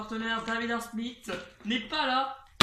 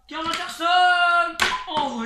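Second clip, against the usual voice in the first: higher, louder, slow shouted name; excited shout; 7 semitones higher, 9 dB louder, slower than usual. Real, not acted. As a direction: belt the name out. Big hit called live.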